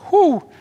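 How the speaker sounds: high, loud sigh of relief; relieved whew. Retold or imitated. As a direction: blow out a big relieved whew. Disciples relieved after storm.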